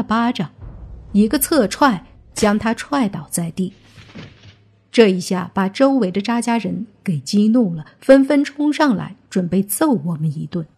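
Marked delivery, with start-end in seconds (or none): none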